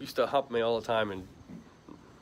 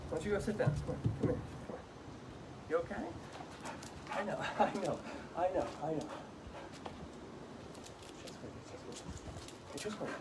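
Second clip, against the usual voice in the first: soft, hushed reassuring murmur; calm the frightened dog gently and softly; soothing a scared dog; 7 semitones higher, 13 dB quieter than usual. Real, not acted. Owner quietly calming his dog scared of the wind.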